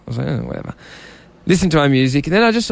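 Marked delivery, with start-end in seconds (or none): 0.3-0.7 low, soft retold shrug; dismissive. Listening to music on the beach, low dismissive mumble. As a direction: brush it off quietly, much lower. His own unbothered reaction, retold.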